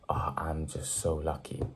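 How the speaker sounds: breathy whispered aside. Retold or imitated. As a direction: whisper it, self-dismissing. Mocking self-dismissive quote.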